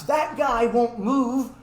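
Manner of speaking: usual speaking voice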